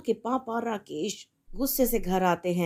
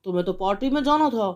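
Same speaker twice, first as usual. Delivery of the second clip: a husband furious about the fine; angry father. Acted. Father rebukes wife.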